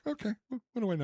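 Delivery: high-pitched, feigned meek giving in; mock meek compliance. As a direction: back down in an exaggerated high little voice. Mimics himself giving in to director.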